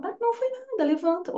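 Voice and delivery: put-on high, light brushing off of a hurt child; a mock parent shrugging off a fall, high and soft; dismissive brush-off.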